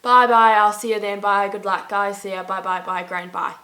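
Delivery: run-on goodbyes, tapering down; Irish goodbye imitation. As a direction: imitate a sign-off that will not end. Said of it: "Even some will be like".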